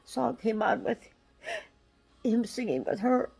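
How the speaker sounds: real tearful strain, pitch pushed up; close to crying. Real, not acted. Can't bear hearing that song, tearful and strained. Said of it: "I wanna cry".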